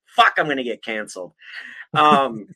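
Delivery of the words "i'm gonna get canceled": normal talking voice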